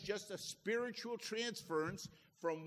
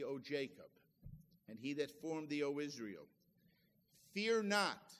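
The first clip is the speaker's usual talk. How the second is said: scripture reading; 5 semitones lower than usual. Acted. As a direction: read the verses like a sermon text. Reads scripture aloud.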